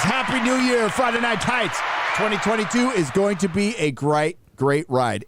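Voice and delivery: high bright message read-out; reading a message aloud. Retold or imitated. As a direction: read the greeting in a high, upbeat voice.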